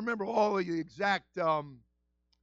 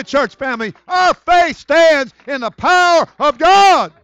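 louder and higher, slow, building preacher's cry; preaching at full force; 8 semitones higher, 14 dB louder, slower than usual. Real, not acted. Pastor preaching to his church family, building to a cry.

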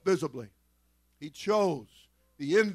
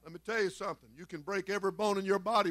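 normal talking voice

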